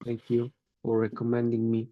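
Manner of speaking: usual speaking voice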